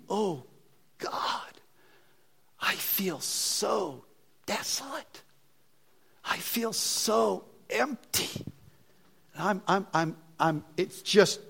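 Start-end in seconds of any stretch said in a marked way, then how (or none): 0.1-8.5 soft, slow, drawn-out lament; halting and desolate, a touch of anguish; retold weeping prayer. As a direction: reenact a quiet tearful cry to God. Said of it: "I'm weeping. And I'm saying".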